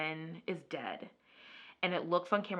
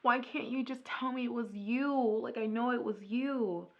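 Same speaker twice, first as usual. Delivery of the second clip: higher, pleading half-cry; mock crying plea; 6 semitones higher than usual. Wife pleading with husband to confess, mock tearful. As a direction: play the tearful wife begging for truth. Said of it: "starts to cry, like".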